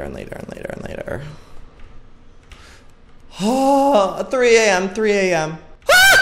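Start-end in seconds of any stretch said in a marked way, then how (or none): 3.4-5.6 anxious outburst. Real, not acted. Anxious as game clock advances.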